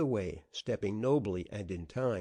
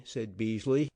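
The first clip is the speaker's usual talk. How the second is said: plain reading voice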